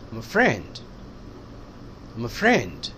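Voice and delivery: friend stressed, I'm a light. Shown as a demonstration. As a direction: lean on friend, skim I'm a. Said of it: "friend पे stress दिया".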